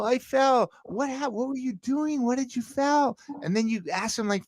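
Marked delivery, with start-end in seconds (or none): 0.0-0.7 put-on hurt child. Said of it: "they go". 0.9-3.2 concerned parent. Retold or imitated. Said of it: "you go".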